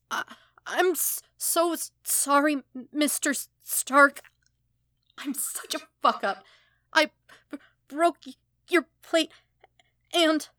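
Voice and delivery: high, loud tearful stammer; crying. Acted. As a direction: sob the apology out, stumbling over every word. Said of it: "hiccuping violently now, shaking from both his sobs".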